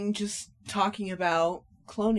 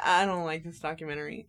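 ordinary talk, nothing marked

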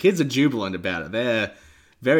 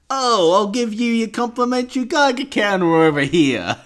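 funny salesman voice; 11 semitones higher, 6 dB louder than usual. Acted. Host demonstrating how the pet shop guy talks, silly high voice. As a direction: push a silly salesman voice way up and stretch it. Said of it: "That's how he talks, right?".

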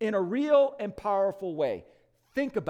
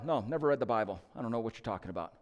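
humble thief; 8 semitones lower, 5 dB quieter, much faster than usual. Retold or imitated. Thief answering the angel at heaven's gate, meek and low. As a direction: lower and softer, a plain humble reply.

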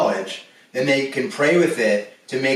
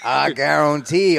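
slow Cajun drawl on guarantee; Cajun accent. Pretend. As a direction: stretch I guarantee like a Cajun cook. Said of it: "I can do a better one than you".

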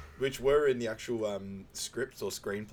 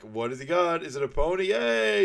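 mock kid excitement; 8 semitones higher, 6 dB louder than usual. Frank mimicking excited kid.

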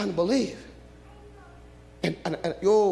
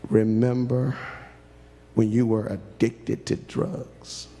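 voice of God; 5 semitones lower than usual. Acted. God speaking to the preacher, low and gentle. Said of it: "He smiled at me, and he looked at me and said".

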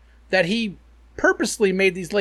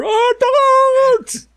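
cheering fan; 7 dB louder than usual. Pretend. Mock cheering darts fan.

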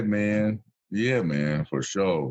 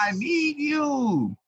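drawn-out pleading; much slower than usual. Retold or imitated. Quoting Chris, drawn out high.

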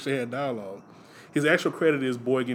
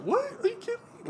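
incredulous; 5 dB quieter than usual. Retold or imitated. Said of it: "they're just like".

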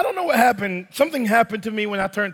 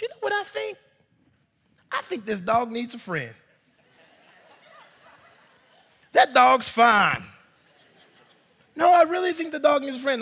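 persuasive wife; 3 semitones higher than usual. Retold or imitated. Wife pitches second dog.

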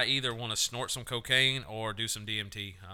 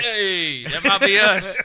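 loud high hype shout; loud hyped shout; excited call; 9 semitones higher, 12 dB louder, much slower than usual. Acted. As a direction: yell it out hyped up.

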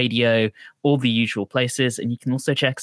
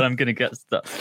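ordinary talk

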